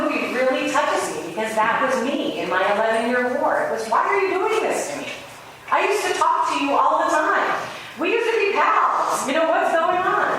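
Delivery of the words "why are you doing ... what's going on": high, hurt plea to the sky; a betrayed friend asking why; higher, imploring why me; pleading to God. Retold or imitated. His own cry to God.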